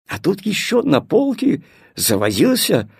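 plain reading voice

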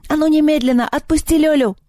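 high-pitched furious demand; angry yell. A narrator's reading. Girl demanding the bird free her friend, angry yell. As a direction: order it high and indignant. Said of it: "закричала она".

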